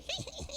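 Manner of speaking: risada aguda imitando o cachorro; risadinha do Muttley. Retold or imitated. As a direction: solte a risadinha do Muttley, bem mais aguda. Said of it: "o Muttley fica rindo". (imitando a risada do Muttley para o público)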